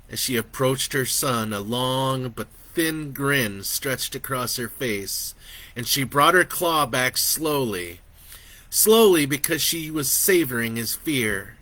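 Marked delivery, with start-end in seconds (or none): none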